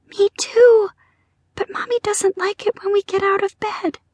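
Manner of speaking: put-on high, small child voice; little girl voice. A narrator's reading. Sister to brother about sneaking out of bed, high girlish voice. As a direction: speak as a tiny girl.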